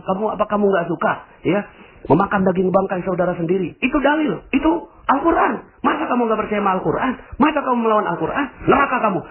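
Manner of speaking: high, preachy reproach; self-righteous scolding. Pretend. Judgmental believer scolds a gossiper with Quran verses, high and smug.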